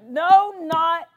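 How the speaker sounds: "no, not" punched high and loud; emphatic negatives. Real, not acted. Preacher corrects himself to the congregation, "no, not" loud and emphatic.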